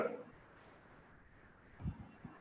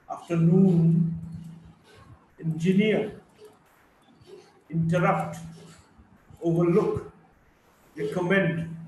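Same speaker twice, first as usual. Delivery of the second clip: main stress last, lighter stress first: af-ter-NOON, en-gi-NEER; third-syllable stress. Shown as a demonstration. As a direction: build to the third syllable, as in o-ver-LOOK, re-com-MEND. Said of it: "Primary stress on the third syllable".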